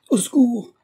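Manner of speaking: high, loud cry of alarm; crying for help. A narrator's reading. Woman attacked by the scoundrel, crying out for help. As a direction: call out sharply for rescue. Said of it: "criait madame Toussaint".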